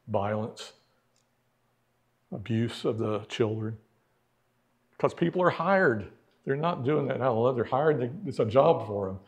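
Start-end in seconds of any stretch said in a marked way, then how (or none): none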